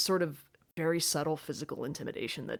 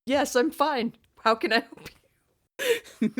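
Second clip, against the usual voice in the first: mock tearful cheer; 8 semitones higher, 5 dB louder than usual. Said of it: "clearly tears streaming down my face".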